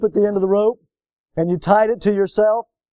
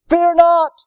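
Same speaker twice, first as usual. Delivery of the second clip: bold command; 10 semitones higher, 6 dB louder than usual. Acted. Elisha's bold reply to the servant.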